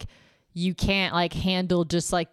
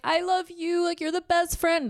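high, teary gushing best friend; mock drunk gushing; 11 semitones higher than usual. Mocking drunk girls crying to their best friend, high gush.

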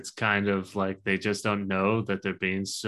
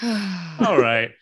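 mock dismissive; 8 semitones higher, 5 dB louder than usual. Mocking a dismissive reply.

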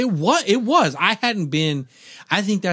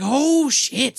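panicked oh shit; 12 semitones higher than usual. Retold or imitated. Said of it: "I'm like".

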